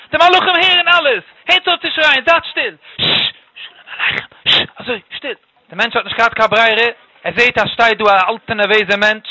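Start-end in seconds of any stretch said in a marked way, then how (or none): 0.1-2.8 higher, exasperated scolding; indignant shouting. Acted. Old guest stops the family's Sabbath singing, indignant and high-pitched. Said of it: "Also ich schreit jetzt". 3.0-5.4 whispered hushing. Acted. Old guest shows how to sing Shalom Aleichem, whispering.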